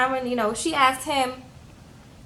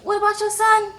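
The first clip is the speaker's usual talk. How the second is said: high, squeaky tipsy voice; tipsy woman; 10 semitones higher than usual. Retold or imitated. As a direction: play her gone on one margarita. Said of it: "but she was gone".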